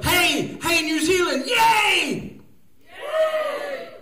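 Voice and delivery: high, loud crowd-pumping shouts; rallying cheer. Pretend. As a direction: whoop to get the room cheering.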